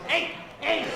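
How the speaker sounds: Uncle Arnie. Retold or imitated. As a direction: babble hey over and over like the goofy uncle. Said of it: "My Uncle Arnie".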